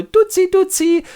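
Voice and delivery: put-on very high, loud baby talk; cooing at baby. Priest coos at the baby boy in high baby talk. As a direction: coo at the infant.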